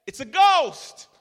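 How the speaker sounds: high, loud scream of fear; terrified cry. Acted. Preacher voicing the disciples' terrified cry.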